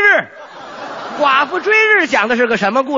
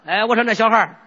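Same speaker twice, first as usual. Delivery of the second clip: put-on Henan accent; 4 semitones lower, 3 dB quieter than usual. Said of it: "带了一个河南夜叉".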